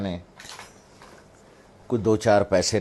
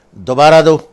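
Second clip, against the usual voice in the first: sharp, loud order, much higher; loud stern command; 6 semitones higher, 15 dB louder than usual. Retold or imitated. Mother sternly orders her son to give again, loud. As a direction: bark the order at your son. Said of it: "انہوں نے بڑے زور سے کہا".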